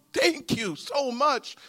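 high, slow tearful thanks; mock crying. Barefoot man thanking the giver for shoes, imitated high crying. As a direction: imitate a grown man thanking through tears. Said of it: "a grown man starts crying".

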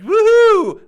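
loud high whoop of fake joy; gleeful whoop. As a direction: whoop it like you're showing off on holiday. Posing on inflatable for photo.

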